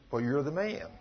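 soft, low, final accusation; grave accusation. Retold or imitated. Prophet softly telling David he is the man. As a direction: let it land quietly. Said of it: "the prophet said".

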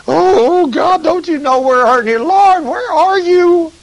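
high, loud, wailing plea to God; begging and pleading. Retold or imitated. Worshipper wailing to God for help, high desperate begging. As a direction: cry out to the Lord like you're desperate. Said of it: "They're begging and pleading".

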